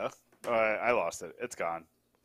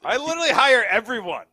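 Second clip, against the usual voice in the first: mock indignant; 8 semitones higher, 8 dB louder than usual. Real, not acted. Indignant protest at comment.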